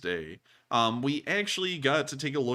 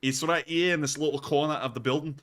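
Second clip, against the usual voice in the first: higher put-on Scottish accent; 5 semitones higher than usual. Host pointing out a gift shop, in mock Scottish. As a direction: play the Scottish tour guide. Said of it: "try and do the Scottish accent".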